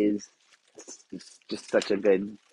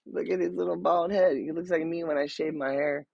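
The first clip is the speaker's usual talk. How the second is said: excited; 8 semitones higher, 3 dB quieter than usual. Real, not acted. Still gushing over the troll.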